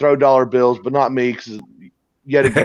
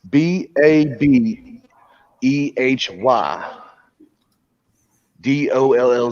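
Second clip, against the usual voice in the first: each letter read slowly, one at a time; spelled out slowly; slower than usual. Retold or imitated. Reading the club's neon sign to friends, slow letter by letter.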